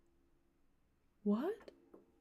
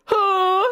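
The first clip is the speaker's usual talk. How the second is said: loud, high held mock gasp; hammed-up drawn-out "huh"; gasp for breath; 11 semitones higher, 7 dB louder than usual. A narrator's reading. Boys fall out gasping for breath, hammed-up high gasp. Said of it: "gasped for breath".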